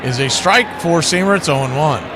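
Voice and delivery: play-by-play. Acted. Calling the game play-by-play.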